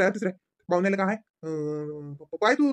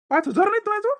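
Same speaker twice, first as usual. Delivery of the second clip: shocked disbelief; 12 semitones higher, 4 dB louder than usual. Acted. Father shocked she has no money.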